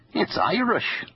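put-on jovial overdone Irish accent; exaggerated Irish brogue. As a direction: ham up an Irish brogue for the toast. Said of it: "he said in an exaggerated brogue".

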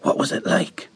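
hushed unvoiced whisper. A narrator's reading. Children whispering over their dead friend. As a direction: breathe the question in a whisper. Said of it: "we whispered".

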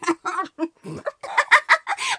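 put-on high childish giggling. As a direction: giggle helplessly. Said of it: "Glucksend kugelten sie sich".

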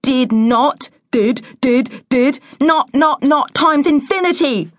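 put-on high, rapid-fire childish squabble; bickering children. A narrator's reading. Brother and sister arguing back and forth, high and insistent.

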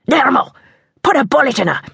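rough hunter urging his men; angry order. A narrator's reading. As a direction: shout the order in the chase. Attacker orders a shot mid-chase.

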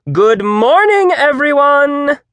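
high, slow, full-voiced greeting; rousing shout. A narrator's reading. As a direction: belt it out to whip up a crowd. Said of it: "she shouted".

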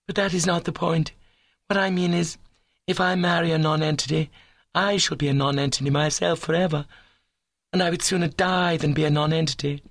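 put-on high, earnest young lady's voice; lofty declaration, held up high in pitch; ambitious young woman. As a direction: play a high-minded girl explaining herself.

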